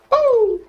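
very high falsetto "uh!"; high victory whoop. Real, not acted. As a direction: hoot in triumph, way up high. Whoops after beating the enemy.

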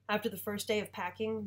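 ordinary delivery, nothing marked

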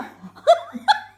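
loud, high burst of laughter; uncontrollable laughter. Real, not acted. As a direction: laugh helplessly, can't hold it in. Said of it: "tutamıyorum kendimi". (tutamadığı tiz kahkaha)

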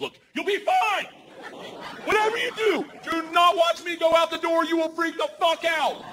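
high, sustained yell; yelling over engines. Retold or imitated. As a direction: imitate someone shouting over engine noise. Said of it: "she starts yelling at me".

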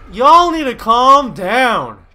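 loud, high, drawn-out scolding; exasperated yell. Real, not acted. Gamer telling characters to calm down, exasperated yell.

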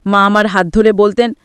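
usual speaking voice

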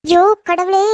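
exasperated cry. Acted. Exasperated girl teased by cousin.

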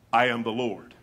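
low, solemn scripture declaration; God's solemn signature line; solemn refrain. Acted. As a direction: drop low and state it with weight.

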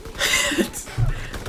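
airy laugh. Real, not acted. Laughs after a match event.

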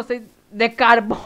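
breaking into a nervous laugh; nervous laughter. Real, not acted. Streamer hearing the coal requirement, laughing nervously. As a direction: let nervous laughter take over the end. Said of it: "es como una risa pero nerviosa".

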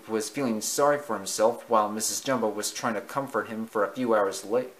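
usual speaking voice

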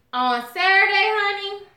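high, loud Saturday hype; hyped announcement. Real, not acted. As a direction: pump the viewers up for the show. Hyping the live show.

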